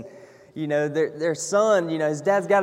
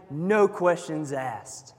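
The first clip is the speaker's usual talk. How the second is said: slow emphasis; 2 semitones lower, much slower than usual. Real, not acted. Preacher slows for emphasis.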